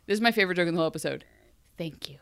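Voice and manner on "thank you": whispered thanks. Retold or imitated. Psychic's line in recap.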